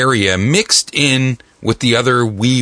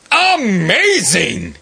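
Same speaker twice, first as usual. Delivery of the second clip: excited; 6 semitones higher, much slower than usual. Real, not acted. Host exclaims about favorite game.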